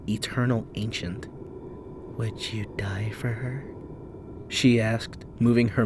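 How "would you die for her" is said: soft breathy whisper; eerie whisper. Acted. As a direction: ancient spirit whispering close. Spirit asks.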